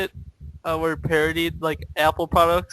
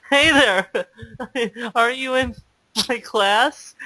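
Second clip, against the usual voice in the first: awkward; 6 semitones higher than usual. Retold or imitated. Awkward teen approaching a girl at school, awkwardly. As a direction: imitate your own awkward approach to a girl. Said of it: "I was really awkward".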